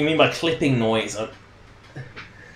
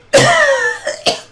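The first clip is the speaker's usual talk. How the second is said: loud, hard cough; a sudden, violent bout of coughing; coughing fit; 6 dB louder than usual. Real, not acted. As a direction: hack out a loud cough mid-sentence. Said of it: "coughing my guts out".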